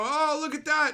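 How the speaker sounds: squeaky mock fangirl gush; high, loud mock cutesy gasp; mocking anime fan. Mocking anime fans online, high gushing voice.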